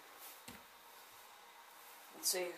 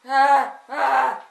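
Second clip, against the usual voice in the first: two sharp loud frightened yelps; startled scream; 16 dB louder than usual. Real, not acted. Startled by the squirrel on the stairs, loud frightened yelp. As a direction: cry out in real fright at the squirrel. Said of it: "I'm scared".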